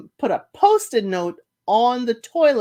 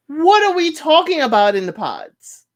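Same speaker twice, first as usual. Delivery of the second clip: loud, higher, exasperated demand; exasperated outburst; 7 semitones higher, 7 dB louder than usual. Retold or imitated. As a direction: blurt it out like you can't believe it. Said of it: "So I'm like".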